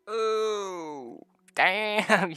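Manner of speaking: high, drawn-out amazement; mock shock. Player seeing one point of exhaustion, mock shock. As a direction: stretch it high, then land loud. Reacting to friend's exhaustion on sheet.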